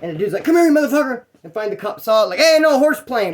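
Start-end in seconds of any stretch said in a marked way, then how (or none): 0.4-1.2 high mock yell mid-chase; retold angry call. Pursuer chasing him round the carts, mock angry. 2.4-3.3 high, louder retold order; mock cop's call. Cop breaking up inmates' chase, mock commanding shout.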